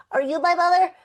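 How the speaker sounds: put-on baby bird asking the penguin, in a high chick voice; little bird. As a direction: plead like a tiny lost bird.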